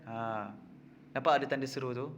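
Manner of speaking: ordinary delivery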